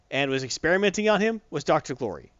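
ordinary talk

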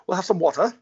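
high, loud transatlantic water; hammed-up transatlantic with a strong T; put-on old-time transatlantic voice; transatlantic accent. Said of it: "pronounce those T's very strongly".

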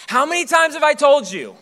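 high, loud exasperated wife; fed-up wife scolding her husband; wife losing patience over the diaper bag; impersonating wife. Retold or imitated. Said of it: "uncanny impersonation right there".

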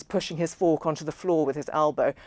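ordinary delivery

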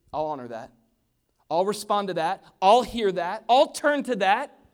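loud, rising declaration; ringing, forceful pledge; emphatic promise. Acted. Preacher voices Jesus answering the cry.

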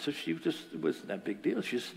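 ordinary delivery

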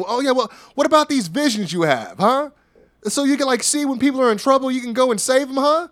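high, taunting impression, rising on each jab; Waller trolling. Waller needles Apollo about his visions, trolling. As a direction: needle him in a high mocking voice. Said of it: "then Waller starts trolling".

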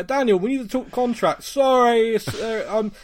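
hammed-up busy chairman on the phone; mock Levy. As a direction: brush off the caller mid-job. Acted Levy brushing off contract talk.

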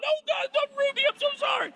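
very high, wailing mock apology; exaggerated tearful pleading; hammed-up repenting. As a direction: beg forgiveness in a comic high wail. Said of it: "I called up my wife and I repented".

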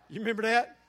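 asks through a loud laugh, fast; laughter spilling over the question. Real, not acted. Preacher laughing to congregation about son's jab, loud laugh.

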